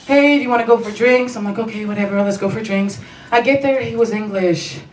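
ordinary talk, nothing marked

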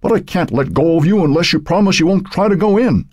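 high-pitched, urgent insistence; insistent refusal. A narrator's reading. Fireman to the old woman in his grip, insistent.

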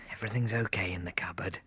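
softly whispered self-reassurance. Acted. Mark hiding in the stationery cupboard, whispering to himself. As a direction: whisper it to calm yourself in hiding.

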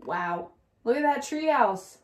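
ordinary delivery

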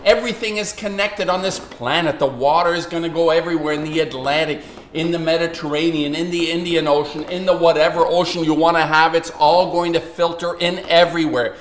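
normal talking voice

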